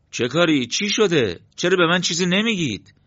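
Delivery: high, loud anxious questioning; agitated, insistent questions; alarmed demand. Acted. Brother demands to know what happened.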